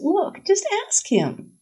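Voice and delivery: high, drawn-out urging; exasperated sigh. A narrator's reading. Sarah advises Nora when she doesn't answer, exasperated. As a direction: urge her slowly, as to the obvious. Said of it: "Sarah sighs and says".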